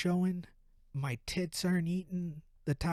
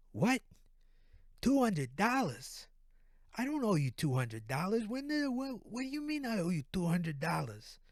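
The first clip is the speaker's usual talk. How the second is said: incredulous East Coast guy, pitch jumping up; disbelieving East Coast phone voice; East Coast accent; 5 semitones higher than usual. Acted. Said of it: "East Coast accent".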